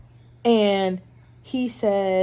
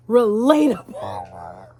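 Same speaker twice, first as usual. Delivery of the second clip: drawn out; 7 dB louder, much slower than usual. Real, not acted. Repeated slowly.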